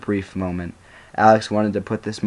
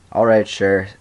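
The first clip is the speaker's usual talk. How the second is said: plain reading voice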